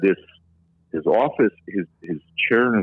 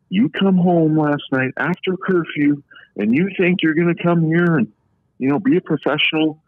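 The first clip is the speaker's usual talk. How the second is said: retold scolding; 10 semitones higher than usual. Coach scolding him after curfew.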